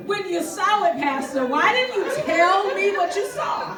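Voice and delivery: slow, loud, laughing demand, pitched high; half-laughing yell at the pastor; laughing mock shout. To her pastor, a laughing slowed mock shout. Confronting pastor in retold anger.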